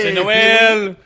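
high, loud festive cheer; excited shout. Real, not acted. Celebrating with a shot.

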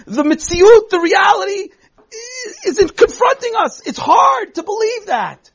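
real emotion breaking through as she pushes on. Real, not acted. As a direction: voice cracking up high with held-back tears. Teacher overcome describing grim reality.

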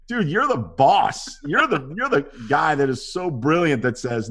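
high, emphatic fan praise; incredulous admiration. Retold or imitated. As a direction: gush at the big boss in disbelief. Acted praise to studio boss.

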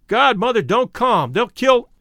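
put-on boy warns Mother of gunmen, screaming; panicked scream. A narrator's reading. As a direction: scream it to stop her coming near. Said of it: "One of the boys screamed".

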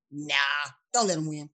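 usual speaking voice

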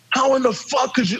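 mild mock rant from the coach; a touch of the coach's outrage; retold angry coach. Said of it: "we're having a screaming match".

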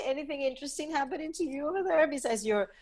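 ordinary delivery

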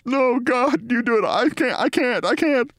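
high, whimpering mock refusal; Bill Maher, mortified mumbling. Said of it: "shakes his body no and mumbles".

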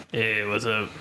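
low imitation of a husky celebrity; imitating her voice. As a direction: drop low to mimic her voice. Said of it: "her voice is like".

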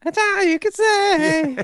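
very high squeaky taunt; mock squealed jeer; cartoon sister. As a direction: echo a little girl's tease way up high. Co-host echoing the cartoon sister.

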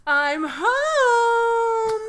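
high, loud call with HOME stretched out. Acted. Harry announcing himself at the Dursley house, calling out. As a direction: sing out your arrival, drawing out the last word. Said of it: "dropped down his bags and went".

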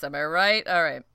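usual speaking voice

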